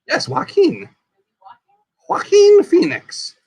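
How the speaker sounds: Joaquin said high and deliberately as wah-KEEN; emphatic name pronunciation. Shown as a demonstration. Host insisting on an actor's name, Joaquin pronounced with emphasis. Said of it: "Not walking, Joaquin".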